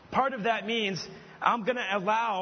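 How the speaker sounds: usual speaking voice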